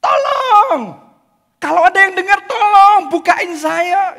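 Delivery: high, loud cries for help; shouting for help. Acted. Juan trapped in the freezer, shouting for help. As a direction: shout it trapped in the cold room. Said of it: "Dia teriak-teriak".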